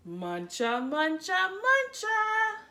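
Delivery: building munch. A narrator's reading. Bunnies munching crescendo.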